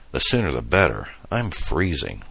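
usual speaking voice

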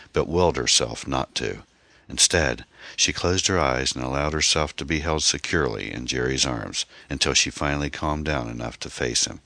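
ordinary delivery, nothing marked

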